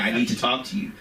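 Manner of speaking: ordinary talk, nothing marked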